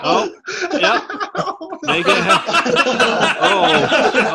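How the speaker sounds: high, laughing out loud; cracking up while cheering. Real, not acted. Watching the helmet stunt.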